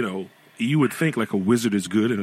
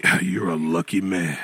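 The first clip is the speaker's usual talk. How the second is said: put-on lower, gruff old-timer compliment; low old man voice; 7 semitones lower than usual. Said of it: "They say".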